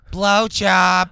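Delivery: a hint of an old lady shouting; old woman yelling. Eighty-year-old woman yelling at bingo, mild mock yell. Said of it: "eighty-year-old woman yells".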